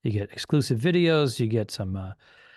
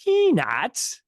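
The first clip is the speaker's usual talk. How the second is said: surprised peanuts; 10 semitones higher than usual. Real, not acted. Surprised about airline peanuts.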